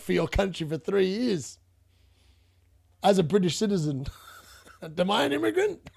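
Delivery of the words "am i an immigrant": high laughing question. Real, not acted. To Sir Jim, a disbelieving laughing question. Mocking the immigrant claim, laughing.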